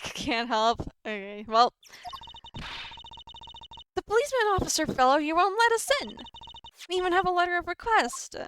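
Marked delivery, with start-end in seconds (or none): 4.0-8.4 high-pitched complaining character voice; complaining game character. Acted. Complaining that the officer won't let them in, high-pitched. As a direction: pitch it well up and plead your case.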